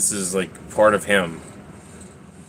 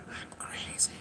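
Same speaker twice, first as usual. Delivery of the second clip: whisper; 23 dB quieter than usual. Real, not acted.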